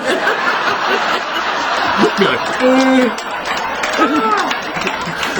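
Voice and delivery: long laugh at his own punchline. Real, not acted. Joker laughing after the second cat punchline, laughing. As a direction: let the laugh roll.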